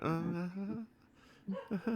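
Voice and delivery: unenthusiastic uh-huh. Retold or imitated. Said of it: "You went".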